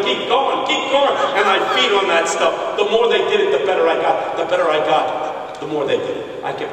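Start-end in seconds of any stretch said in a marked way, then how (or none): 0.0-1.1 higher-pitched cheering; imitated men's yell. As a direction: urge the preacher on, mock yelling. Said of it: "men were yelling".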